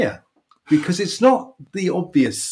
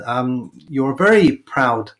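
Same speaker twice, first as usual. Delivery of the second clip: ordinary talk, nothing marked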